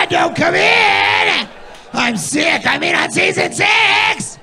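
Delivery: high, loud, slow hammed-up shouting; mock yell. Girlfriend hiding in the bathroom, mock yelling high and slow.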